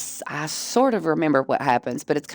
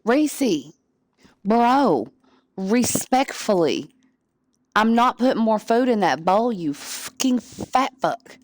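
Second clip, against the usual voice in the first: higher, slow, playful telling-off; mock-stern scolding; 5 semitones higher, slower than usual. Real, not acted. Speaking to her cat about food, mock stern. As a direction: scold the greedy cat with exaggerated respect.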